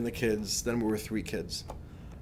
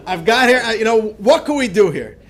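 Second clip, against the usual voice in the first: laughing excitement; 9 semitones higher, 13 dB louder than usual. Retold or imitated. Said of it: "you're, of course".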